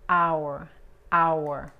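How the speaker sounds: "Hour" said loud, like a Spanish A with English R; pronunciation demo. Shown as a demonstration. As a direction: say "hour" loud, relaxed A then R. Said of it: "se pronuncian como la A en español". ("Hour" fuerte, con A relajada y R inglesa)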